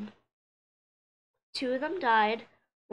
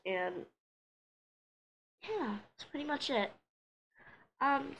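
usual speaking voice

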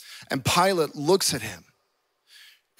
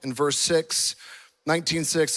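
ordinary talk, nothing marked

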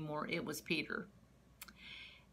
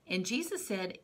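ordinary delivery

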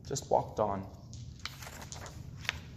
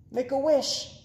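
put-on high excited girl's call; excited make a wish, high; eager cry; 11 semitones higher than usual. A narrator's reading. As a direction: urge him eagerly, much higher. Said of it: "cried Amy".

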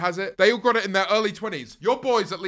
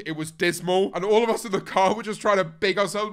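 usual speaking voice

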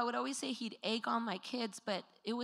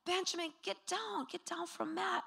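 high-pitched mom reprimand, not raised; high, restrained telling-off; embarrassed scolding; 9 semitones higher than usual. Retold or imitated. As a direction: imitate a mother urgently correcting her child. Mother telling child off in public.

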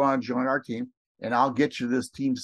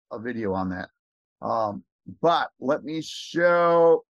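ordinary talk

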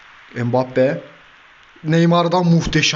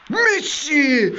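excited call; 13 semitones higher than usual. Real, not acted. Hopeful call on shot.

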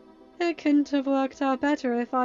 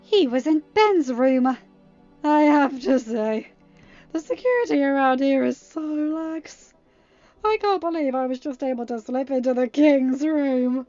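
loud, higher, gloating boast; smug snickering; 5 semitones higher, 12 dB louder than usual. A narrator's reading. Intruder boasting about slipping past security, smug. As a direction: gloat with relish, drawing it out. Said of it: "Freddy said with a slight snicker".

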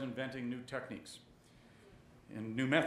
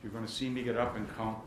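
ordinary delivery

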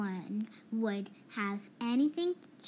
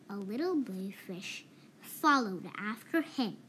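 usual speaking voice

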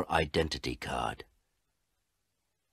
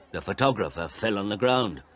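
usual speaking voice

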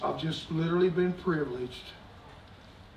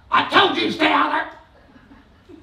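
mock angry yell, higher and louder, half laughing; scolding parent; 11 semitones higher, 11 dB louder, slower than usual. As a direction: bark like a parent catching a kid. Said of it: "you hear somebody behind you go".

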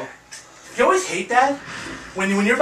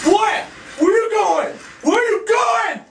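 yelling; 14 semitones higher, slower than usual. Real, not acted. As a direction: yell after the fleeing enemy. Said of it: "I shouldn't yell".

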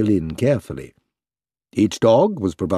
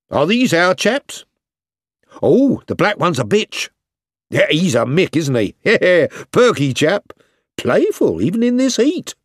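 cheery soldiers; 8 semitones higher than usual. A narrator's reading. Soldiers chatting round dogs.

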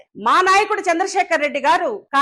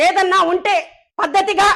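usual speaking voice

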